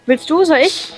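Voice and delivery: ordinary talk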